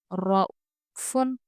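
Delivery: very slow रऊफुन with a full, heavy ra; heavy ra recitation. Shown as a demonstration. Teacher reciting रऊफुन slowly for students. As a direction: stretch रऊफुन, the ra thick and open. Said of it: "रॉ पुर पढ़ेंगे".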